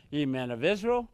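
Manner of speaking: usual speaking voice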